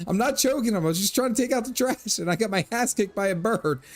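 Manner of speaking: squeaky flustered protest, mock pleading; high-pitched frazzled excuse after being attacked by a bird; flustered self. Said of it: "I was like".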